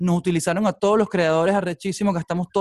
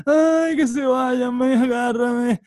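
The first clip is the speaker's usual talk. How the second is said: pained cry; 6 semitones higher, much slower than usual. Retold or imitated. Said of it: "es cuando dices".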